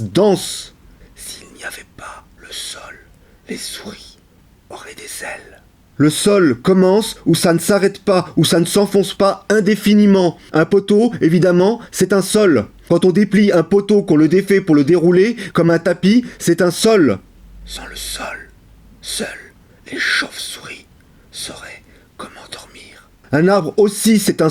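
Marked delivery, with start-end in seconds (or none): 1.2-5.6 hushed whisper with long pauses; whispered poem. Acted. Speaker privately thankful for the ground, whispered. 17.6-23.1 hushed whisper, broken by pauses. Acted. Sleepless speaker musing on bats, whispered.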